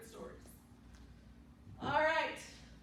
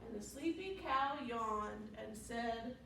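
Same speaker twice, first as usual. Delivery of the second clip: put-on reading to a toddler; 3 semitones higher, 6 dB louder than usual. Reads story to small children.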